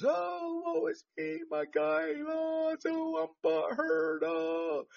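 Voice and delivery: high, soft whiny victim voice; mock self-pity, sighing high; dramatic sigh. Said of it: "And now they're playing the victims".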